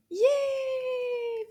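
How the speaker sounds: high, loud squealed cheer; a puppet shrieks with joy; puppet shout. Pretend. Puppet Anana cheers the class loudly.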